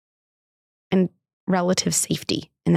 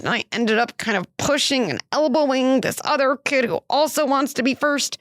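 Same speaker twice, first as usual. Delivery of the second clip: put-on higher, eager little-boy telling; imitating her son; 7 semitones higher than usual. Mother voicing her son's recess confession, higher and eager. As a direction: tumble it out like an excited six-year-old. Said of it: "Then he added this kicker".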